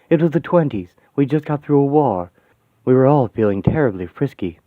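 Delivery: ordinary delivery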